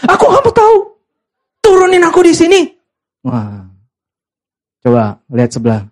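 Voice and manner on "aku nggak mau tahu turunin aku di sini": very high hammed-up tantrum; shrieky mock refusal from the girlfriend; quarrelling girlfriend. Girlfriend refuses to listen in the car, high mock tantrum. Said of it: "eh, mereka berantem".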